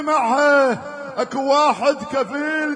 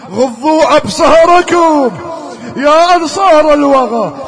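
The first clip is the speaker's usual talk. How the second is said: commanding shout; 14 dB louder than usual. Acted. Habib ordering the companions, loud and commanding. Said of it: "يقول الأصحاب".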